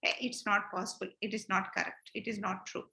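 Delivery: low, soft, dismissive brush-off; dismissive child. Retold or imitated. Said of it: "what will you think?".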